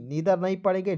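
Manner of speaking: ordinary talk, nothing marked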